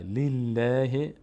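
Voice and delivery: لله very slow, with light ll and fronted ae; thin, light lam. Shown as a demonstration. Teacher repeating the thin reading, slow light lam. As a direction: stretch لله out, tongue light and forward.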